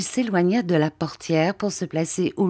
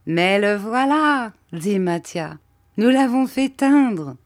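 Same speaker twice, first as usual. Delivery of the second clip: Mathia's reveal; 5 semitones higher, 4 dB louder, slower than usual. Acted. Mathia reveals the dyed dog.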